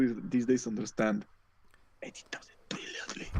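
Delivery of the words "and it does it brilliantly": hushed whisper of praise. Real, not acted. Friend whispering praise of a horror film, hushed. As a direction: drop to a whisper for emphasis.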